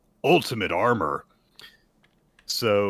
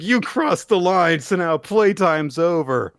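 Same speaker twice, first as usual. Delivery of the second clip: higher, loud villain threat; hammed-up menacing Yoru; 9 semitones higher, 8 dB louder than usual. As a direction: announce it like a boss getting serious. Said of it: "And she's like".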